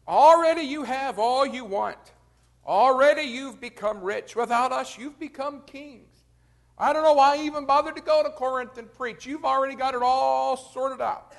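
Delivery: Paul mocks the self-satisfied Corinthians; sarcastic. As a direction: needle them with exaggerated praise. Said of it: "a string of sarcastic comments".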